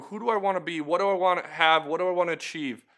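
normal talking voice

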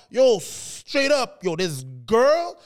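put-on slow, emphatic New York street accent; Queens storyteller accent. Someone on a Queens street telling a story, emphatic New York accent. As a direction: stretch the words like a Queens storyteller. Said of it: "Queens, qui contait une histoire".

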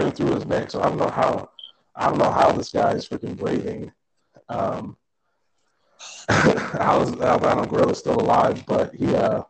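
choppy robot-like voice; broken digital connection sound; robotic glitchy audio. Real, not acted. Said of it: "You're talking like a robot on your side".

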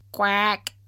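short imitated quack; offended little quack; duck quack. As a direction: interject with a duck's quack. Duck interrupts at cooked birds.

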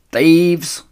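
loud, high "Thieves"; shrieking. Acted. Rat shrieks at the fleeing foxes, loud and high. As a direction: screech after them. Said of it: "shrieked Rat".

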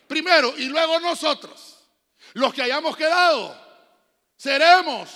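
loud, high fiery preaching; preaching at full force. Acted. Pastor thundering the crucifixion scene to the congregation, loud. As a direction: preach it to the rafters.

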